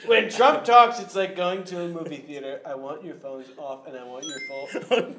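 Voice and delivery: loud, scolding Trump voice; Trump impression. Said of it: "President Trump here".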